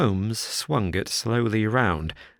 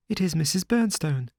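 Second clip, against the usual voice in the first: higher, lightly voiced nervous little man; Sholto's voice; 8 semitones higher than usual. A narrator's reading. Sholto's voice in the story.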